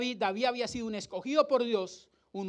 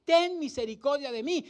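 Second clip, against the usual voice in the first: drawn-out, higher-pitched cry for mercy; retold shouting; 6 semitones higher, 3 dB louder than usual. Preacher acting the blind man calling to Jesus, retold shouting. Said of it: "empieza a gritar".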